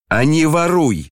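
высокий, громкий злой окрик; выкрикнуть в злости; злой крик. A narrator's reading. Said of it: "крикнул Ганя, чуть не захлёбываясь от злости". (злой окрик Гани)

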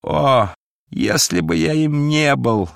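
high anguished lament; wretched cry. A narrator's reading. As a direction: cry out in bitter regret. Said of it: "закричал несчастный".

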